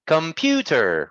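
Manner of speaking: "Computer" slow, with PU high and long; stress on PU. Shown as a demonstration. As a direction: stretch the oo in "Computer". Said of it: "We stress the oo".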